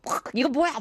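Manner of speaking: imitating a recoil at a salty taste; disgusted surprise. Senior tasting Vegemite, mock disgusted surprise.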